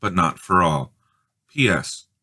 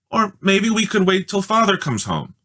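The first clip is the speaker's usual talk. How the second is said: much higher pleading; hopeful plea; 12 semitones higher than usual. A narrator's reading. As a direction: grasp at a way out. Scared Peter pleads.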